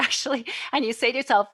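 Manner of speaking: ordinary talk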